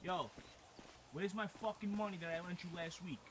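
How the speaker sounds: usual speaking voice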